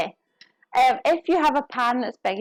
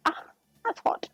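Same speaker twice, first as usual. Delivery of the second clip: breathless whisper, suddenly higher; whispered wince; 10 semitones higher, 5 dB quieter than usual. Real, not acted. Cook whispering as the hot pan stings. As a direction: hiss it out as the pan burns.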